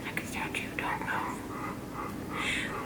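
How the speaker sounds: hushed whisper. Real, not acted. Teacher whispering to children to freeze, soft whisper. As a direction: breathe it so nobody moves. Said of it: "Can you hold very still".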